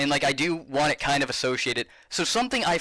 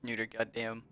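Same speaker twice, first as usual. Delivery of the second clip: ordinary talk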